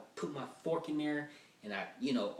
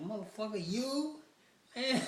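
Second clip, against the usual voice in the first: much higher, stunned; incredulous outburst; 10 semitones higher than usual. Retold or imitated. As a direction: blurt it in shock. Disbelieving reaction.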